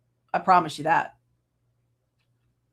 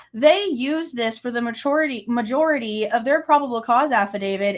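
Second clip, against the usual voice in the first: ordinary talk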